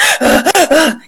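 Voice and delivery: imitated shocked hyperventilating; acted sobbing gasps; panicked gasping. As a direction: gasp in mock panic, as if in shock. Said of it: "yo estaba así de que".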